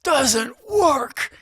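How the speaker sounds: high-pitched abrupt yell in someone's face; imitated scream. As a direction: yell it high, cutting the other person off. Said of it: "He just screams at me".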